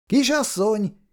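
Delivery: put-on high call to a girl across the meadow; first high call of Miss. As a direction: call out to get her attention. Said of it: "Szabó János elkiáltotta magát".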